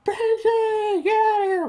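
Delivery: high, loud mock scream of Benji's name; retold frantic cry to Benji; imitating her scream. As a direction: scream a warning through a gag, hammed up. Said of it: "she's literally screaming, like".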